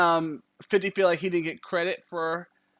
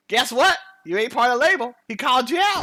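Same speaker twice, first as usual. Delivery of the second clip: loud, high, mocking radio call-out; loud taunting call; 6 semitones higher, 7 dB louder, faster than usual. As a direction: shout it like a gloating caller. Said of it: "someone else called it".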